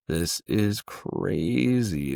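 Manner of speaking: very slow, stretched-out amazement; drawn-out disbelief. Retold or imitated. Driving off into Herat, a slow stretched-out marvel. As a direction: let each word hang in disbelief.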